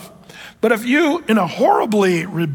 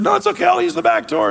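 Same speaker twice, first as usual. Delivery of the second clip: put-on much higher boyish voice, carefree; kid's voice; 11 semitones higher, faster than usual. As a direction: play the cheeky boy, pitched way up. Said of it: "And Billy said".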